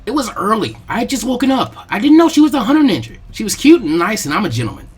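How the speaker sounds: put-on a flustered boy insisting he's innocent; defensive teen voice climbing in pitch; flustered Naruto.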